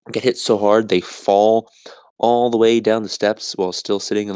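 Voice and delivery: normal talking voice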